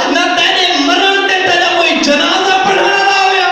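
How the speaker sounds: high-pitched, forceful sermon delivery; fiery preaching. Acted. Preacher rebukes those disrespecting the scholars, fiery preaching. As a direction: preach it hard to the crowd.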